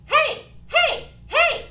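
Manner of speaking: high, loud, lighter mixed heys. Shown as a demonstration. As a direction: keep the mix but let it lighten. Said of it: "let's try that activity one more time".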